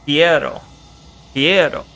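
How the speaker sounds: "Piero" with a v sound, as the game says hello; mimicking mispronunciation. Shown as a demonstration. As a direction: say "Piero" as "vieto", copying the game's sound. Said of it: "you're saying".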